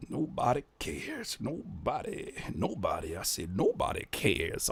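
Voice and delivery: low, hushed and slow, lingering on each word; soft, drawn-out echo. Pretend. Reactor repeating the song title to himself, softly drawn out. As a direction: murmur it slowly, trailing out the vowels.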